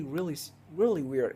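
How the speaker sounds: ordinary talk, nothing marked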